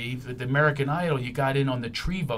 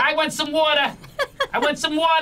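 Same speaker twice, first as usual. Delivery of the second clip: hammed-up New York tough guy asking for water; mock New York accent; 13 semitones higher, 3 dB louder than usual. Host mocking a New York take on water, hammed-up. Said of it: "That's more Wolf of Wall Street".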